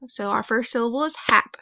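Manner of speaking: ordinary delivery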